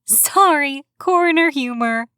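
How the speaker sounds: put-on apologetic joking young woman. A narrator's reading. Erica jokes, higher.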